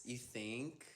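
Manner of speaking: ordinary talk, nothing marked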